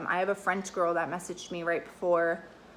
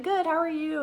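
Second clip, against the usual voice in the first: much higher, quick imitation of her reply; imitating the girl's reply; 10 semitones higher than usual. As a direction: toss it back up high like her.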